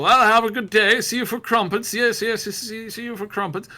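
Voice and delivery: high, cheery mock-British neighbours; chirpy small-town greeting. Passers-by greeting each other on the street, hammed-up and cheery. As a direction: ham up polite villagers swapping hellos. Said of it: "everybody says".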